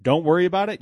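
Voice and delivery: echoing the boss's words in outraged disbelief; incredulous echo. Retold or imitated. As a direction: repeat "don't worry about it" as a stunned question, pitch jumping up. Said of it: "And I go".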